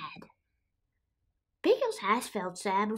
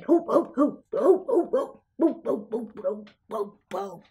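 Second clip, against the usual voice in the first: short rhythmic hoops; bouncing hoots, fading off at the end; monster whooping. Acted. Monsters whooping while being ridden.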